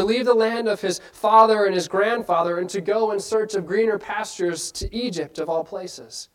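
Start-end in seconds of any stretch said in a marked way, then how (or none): none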